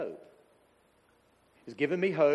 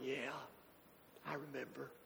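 soft recollection; 17 dB quieter than usual. Retold or imitated. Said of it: "Josh going".

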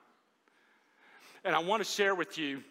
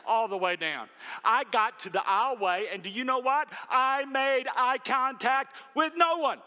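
ordinary delivery

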